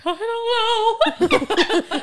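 loud, high mock wail; imitated bawling. Answering boyfriend's "what's wrong" in retelling, hammed-up bawling. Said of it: "I was bawling".

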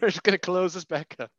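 high, loud, laughing orders; mock shooing. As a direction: shoo the kid away in joking alarm. Joking parent shooing demonic kid away.

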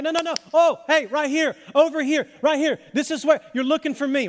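urgent, high, loud redirecting call. Acted. Parent to a kid looking around lost, calling out urgently. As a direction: call them over through the crowd. Parent waves down searching child.

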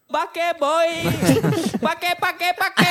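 high, loud mimicked yell of "Pake boy!"; mock shouted call. Friend retelling pickers' shout, high loud mock yell. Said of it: "kun ne huutaa".

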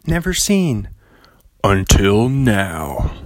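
slow dramatic movie trailer read. Shown as a demonstration. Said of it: "that movie guy voice".

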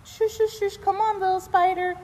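high, gentle shooing; coaxing a spider. Retold or imitated. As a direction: coax it like a pet. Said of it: "Nicole ended up".

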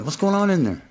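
put-on older son asking a servant about the noise, high puzzled; puzzled older brother. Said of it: "he asks somebody".

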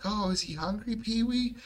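high, cartoonish puppet voice. Puppets ask Pee-wee about the dog in a high puppet voice. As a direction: chirp it like a kids' show puppet. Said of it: "all the puppets are like".

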